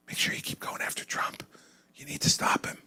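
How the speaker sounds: hushed secretive whisper; an old woman's nervous whisper. Retold or imitated. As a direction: whisper it so friends won't hear. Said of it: "why are you whispering?".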